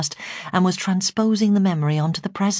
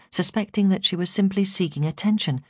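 ordinary delivery, nothing marked

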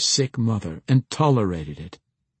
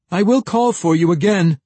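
loud, high-pitched parting call; calling after her; 10 semitones higher, 8 dB louder than usual. A narrator's reading. Lord to the young woman leaving the room, calling after her. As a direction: call out to someone hurrying away. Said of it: "Lord Ferringle said after her".

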